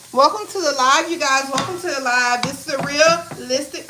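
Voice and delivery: upbeat livestream host; lively welcome. Real, not acted. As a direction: greet the viewers with energy. Hosting intro, announcing.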